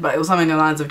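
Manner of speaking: ordinary delivery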